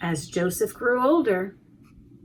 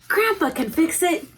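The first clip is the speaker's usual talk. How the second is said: put-on high, eager child's voice; little Joseph; 7 semitones higher than usual. Boy telling his mother grandpa will help, high and eager. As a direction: pipe it up like a hopeful boy. Child voice in read-aloud story.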